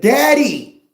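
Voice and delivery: put-on high, loud little kid calling Daddy; child's voice. Kids at home crying out to Daddy, high and loud. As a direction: squeal it like a small child.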